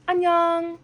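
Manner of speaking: high, loud blunt casual greeting to an elder; rude casual hi. Shown as a demonstration. Greeting an older adult too casually, high and loud. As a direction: toss off a cheeky hi at an old lady. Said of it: "don't do that".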